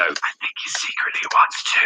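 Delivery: low teasing whisper. Real, not acted. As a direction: whisper it like a secret. Teasing aside about Luke.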